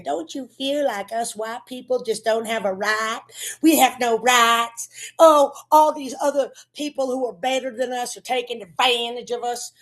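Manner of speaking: hammed-up twang of aggrieved whining; mock ignorant twang. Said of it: "willful ignorance to keep that twang".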